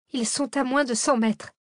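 high, anxious report; alarmed warning. A narrator's reading. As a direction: warn them the pursuers are closing in. Cécile alerte que poursuivants approchent. (alerte inquiète, voix aiguë)

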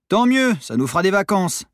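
high, loud exasperated comeback; snappy retort. A narrator's reading. Monitor to troublesome pupil, loud retort. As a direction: fire back, glad to be rid of them.

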